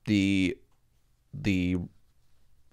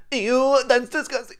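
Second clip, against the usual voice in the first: high, squealing disgust; squeamish girlfriend; 6 dB louder, slower than usual. Retold or imitated. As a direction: shriek it like she's grossed out. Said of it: "She was freaking out".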